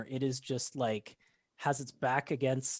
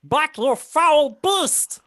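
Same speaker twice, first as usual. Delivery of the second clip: loud, high kobold shout; Molo; 15 dB louder, much slower than usual. Acted. Kobold warns off the panther bearing down, high defiant shout. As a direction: bellow a slow, high-pitched warning. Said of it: "He'll say".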